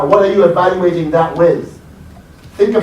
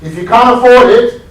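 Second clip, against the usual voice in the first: call to the audience; 6 semitones higher, 8 dB louder than usual. Real, not acted.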